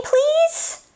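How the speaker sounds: high, begging please; pleading. Acted. Reader begging the listener to let him stop, high pleading. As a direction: beg in a high voice.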